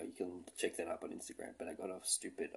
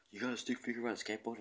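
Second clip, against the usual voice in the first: higher, fast, amazed stranger voice; quick, higher mock disbelief; 5 semitones higher, much faster than usual. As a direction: rattle it off like a stunned guy at the pub. Retelling a stranger's amazed question.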